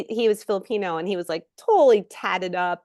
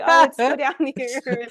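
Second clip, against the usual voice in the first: laughing concierge reply; 6 semitones higher, 7 dB louder than usual. Retold or imitated. Playing concierge to real guests.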